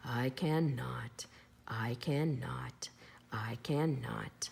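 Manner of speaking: slow whispered chant dying away; tired engine muttering as it leaves; fading chug. A narrator's reading. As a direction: chug it out like an engine rolling off into the distance. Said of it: "off he rumbled to the roundhouse chugging".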